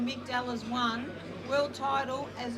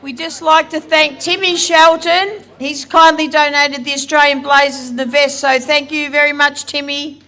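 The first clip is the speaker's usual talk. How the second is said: much louder, amplified through a mic; slow, booming microphone thanks; 3 semitones higher, 21 dB louder, slower than usual. Real, not acted. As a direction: announce it over the PA. Speaking into the microphone.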